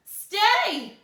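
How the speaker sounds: high, loud monster order; scream. Acted. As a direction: scream the command. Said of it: "he screamed".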